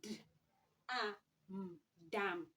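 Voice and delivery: "D, a, m" said as separate sounds, then blended into "dam"; teacher modelling a blend; sounding out letters. Shown as a demonstration. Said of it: "this is d and this is a and this is m".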